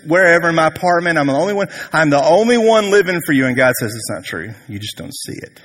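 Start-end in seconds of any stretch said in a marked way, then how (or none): 0.1-3.4 higher, insistent mock complaint; self-pitying lament. Believer feeling alone in living for God, higher mock lament. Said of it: "when you feel like". 3.9-5.6 hushed, much softer and lower; gentle correction. Retold or imitated. God answering Elijah's complaint, quiet gentle correction.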